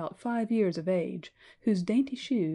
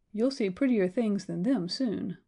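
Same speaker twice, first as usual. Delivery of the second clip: ordinary delivery, nothing marked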